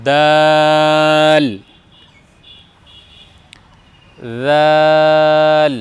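দাল and জাল held long and slow; drawn-out Arabic letters. Shown as a demonstration. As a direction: stretch দাল, জাল evenly. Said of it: "আমার সঙ্গে বলতে থাকুন". (দাল, জাল টেনে লম্বা উচ্চারণ)

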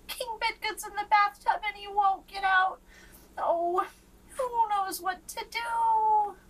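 high, loud fretful page voice; young page fretting about the king; worried page. A narrator's reading. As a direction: plead for help, anxious and high. Page's worried plea.

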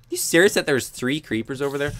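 high, loud incredulous question; can't believe what you see; shocked disbelief. Real, not acted. Gamer spots three creepers at once, shocked disbelief.

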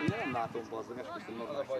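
very low, soft swearing; low grumble. Real, not acted. Coach swearing to himself, grumbling quietly. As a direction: grumble under your breath.

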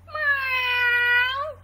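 loud, high, long-drawn meow; cat's call. Acted. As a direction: meow loudly down from a tree. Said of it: "he called down".

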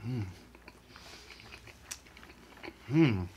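humming while chewing food; muffled closed-mouth sounds; mouth full, chewing. Real, not acted. Seen 3: Bites burger at -2s, closed mouth chewing throughout the "Mm"s.

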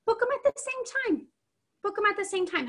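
high, sing-song piece of advice; high-pitched urging. Real, not acted. Coach urging her team to front load their bookings, high-pitched urging. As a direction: pitch it way up, like an obvious tip. Urging listeners to book simultaneously.

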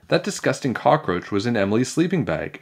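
ordinary delivery

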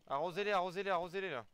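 loud urgent command; shouted order. Real, not acted. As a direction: bark the order to fire. Ordering squad to open fire.

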